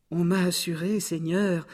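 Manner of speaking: high cat voice addressing a lord; high-pitched, courtly storybook cat; flattering cat. Acted. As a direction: coax the ogre in a high voice.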